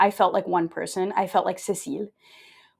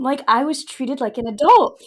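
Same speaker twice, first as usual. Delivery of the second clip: ordinary talk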